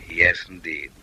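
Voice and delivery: ordinary talk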